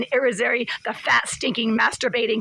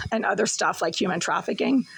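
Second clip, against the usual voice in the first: ordinary talk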